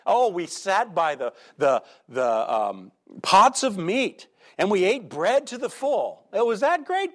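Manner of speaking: hammed-up rosy memories of Egypt, rising high; idealizing mockery. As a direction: gush about the pots of meat like a fond dream. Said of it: "Look at them idealize".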